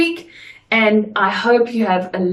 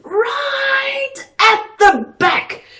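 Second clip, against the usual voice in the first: higher, drawn-out stage call; projected to the back; 14 semitones higher, much slower than usual. Shown as a demonstration. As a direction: stretch it out to carry to the back row.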